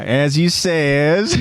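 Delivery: put-on very drawn-out Kenny accent; exaggerated Kenny accent. Narrator copies his own slipped lead-in, exaggerated Kenny accent. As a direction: stretch it right out in Kenny's accent. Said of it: "The accent kinda s-, kinda slips in".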